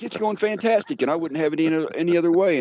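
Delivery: sing-song fake cheer, pitched high; mock bright answer while cleaning up a mess; over-the-top cheery. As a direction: chirp it like nothing could be better. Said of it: "and I'm like".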